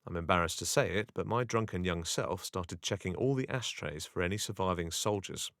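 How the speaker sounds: ordinary delivery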